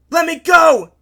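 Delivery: high, full-force yell in a struggle; desperate shout. A narrator's reading. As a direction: scream to break free. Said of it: "Giff shouted".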